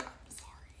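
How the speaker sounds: breathy whisper, no voice; whispered apology. Retold or imitated. Embarrassed contractor apologizing quietly to the cashier, whispered. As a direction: say it sheepishly under your breath.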